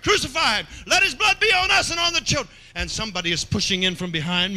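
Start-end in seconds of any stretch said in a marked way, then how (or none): 0.0-2.5 high, loud mob cry; crowd shouting crucify. Pretend. Preacher leading the congregation as the mob, shouting high. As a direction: yell it like the angry crowd. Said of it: "all together now".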